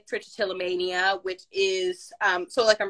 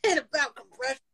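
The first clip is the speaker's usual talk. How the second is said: higher, louder grumpy elder; old-timer voice; 6 semitones higher, 3 dB louder than usual. Retold or imitated. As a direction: tell it like a nostalgic old-timer. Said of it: "You'll be like".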